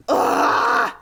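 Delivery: sudden high yelp; a sharp high cry of surprise; startled yelp. Real, not acted. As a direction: jump and yelp in fright. Startled in game.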